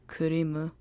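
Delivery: low, unsure Japanese pronunciation; Japanese-accented. A narrator's reading. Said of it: "he butchered the pronunciation".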